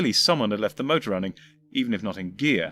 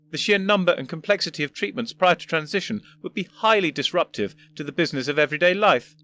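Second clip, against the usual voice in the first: put-on higher, lofty elderly voice; a condescending old professor; pompous old gentleman; 5 semitones higher, 3 dB louder than usual. First Doctor lectures.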